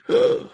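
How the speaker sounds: sudden high gasp of shock; shocked intake of breath; sharp gasp. Real, not acted. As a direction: gasp in real surprise. Host reacts to flooded clinic.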